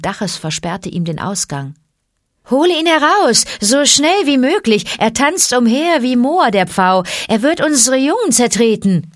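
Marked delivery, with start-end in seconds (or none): 2.5-9.1 high, loud, agitated creature voices; cobras calling out. A narrator's reading. Cobras demand the boy be removed, calling shrilly. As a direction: cry out in urgent complaint. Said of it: "riefen die Kobras".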